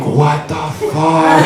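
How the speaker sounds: drawn-out, incredulous question; acted disbelief. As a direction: stretch it out in stunned disbelief. Reacts to the professor's note.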